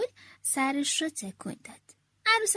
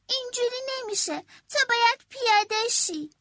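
put-on high, insistent kid voice, louder at the end; frustrated child; 8 semitones higher, 3 dB louder than usual. A narrator's reading. Girl ordering the heavy creature off her bike, frustrated. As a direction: tell him to get off like an annoyed little girl. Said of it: "گفتم".

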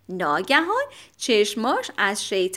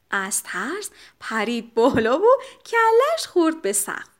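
ordinary delivery

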